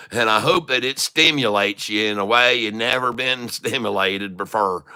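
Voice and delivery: lightly put-on Southern hillbilly accent; country western drawl. Streamer hamming it up for chat in a light country accent. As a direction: play a folksy Southern stock guru, a mild twang throughout. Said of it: "country western talking long enough".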